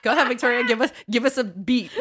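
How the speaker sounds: laughing through a mock encouraging cue; laughing as she mimics the teacher; laughing imitation. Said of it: "she's like".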